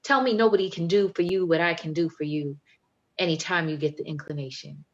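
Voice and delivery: bold, rhythmic poetry performance with swagger; spoken-word poem. Acted. Poet performing Proper Love for the audience, confident spoken word. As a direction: perform it proud and playful, like a love demand.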